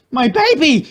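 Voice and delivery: a mother's anguish; scream. A narrator's reading. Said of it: "she screamed".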